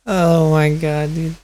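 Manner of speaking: ordinary talk, nothing marked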